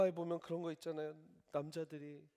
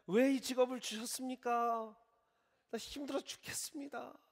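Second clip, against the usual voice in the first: higher, whining grumble; whiny complaint; 10 semitones higher, 5 dB louder than usual. Pretend. Believer complaining to God about his job, whining. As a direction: moan to God like a worn-out worker.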